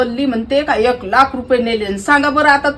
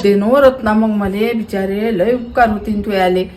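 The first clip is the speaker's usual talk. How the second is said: meek pleading; 4 semitones lower than usual. Retold or imitated. Woman reenacting how she begged her friend for a loan, meek lower plea. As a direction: humbly beg the friend for money. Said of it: "पैसे मागताना काय म्हणत होती हो तू?".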